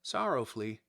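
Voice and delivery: usual speaking voice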